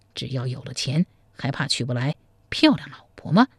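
a crafty monk coaxing in a low voice; scheming monk. Acted. Monk advises the groom to blackmail the family, low and sly.

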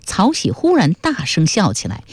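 ordinary delivery

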